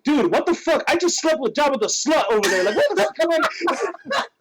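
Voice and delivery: high-pitched comic outrage; indignant outburst. Retold or imitated. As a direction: rant at your friend in appalled disbelief. Acting out confronting Hulk.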